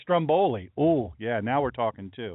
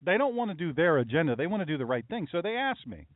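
usual speaking voice

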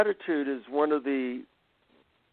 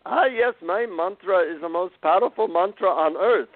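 imitating the guru in an Indian accent, higher; a light Indian accent, pitched up; 5 semitones higher than usual. Guru telling the visitor his mantra, in an Indian accent. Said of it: "he says in his Indian accent to me".

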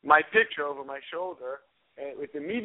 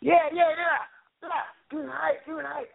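[name] impression. [name] quoted, high.

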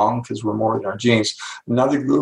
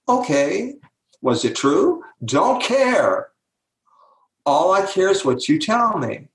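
higher, breezy, unquestioning voice; lightly voiced brain; 7 semitones higher, slower than usual. Brain answering its owner about what to believe, lightly voiced indifference. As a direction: shrug it off as a brain with no opinion.

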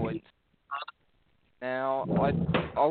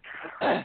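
stifled cough. Real, not acted. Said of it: "I had both a cough".

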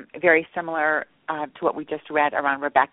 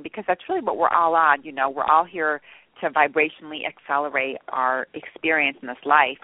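normal talking voice

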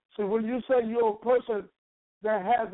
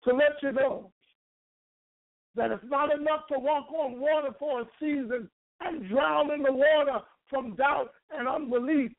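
rising, fervent preacher cadence; preaching; 3 semitones higher than usual. Acted. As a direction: build the preaching up. Preaching climb, higher pitch.

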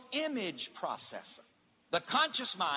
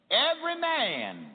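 call to repeat; 7 semitones higher, 5 dB louder than usual. Real, not acted. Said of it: "repeat this after me".

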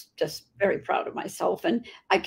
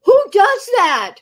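much higher, louder and slow; amazed exclaim; 12 semitones higher, 8 dB louder, slower than usual. Real, not acted. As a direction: marvel out loud at such kindness. Marvelling at friend's kindness.

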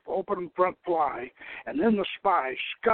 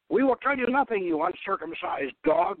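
snarling; 8 semitones higher than usual. A narrator's reading. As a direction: spit the insult at him. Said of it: "Naaman snarled".

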